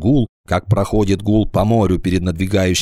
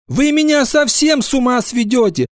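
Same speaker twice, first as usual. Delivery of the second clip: high, exasperated wail; distressed teacher at wits' end; plaintive. A narrator's reading. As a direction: plead helplessly, voice pitched high. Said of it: "жалобно сказала Нина Петровна".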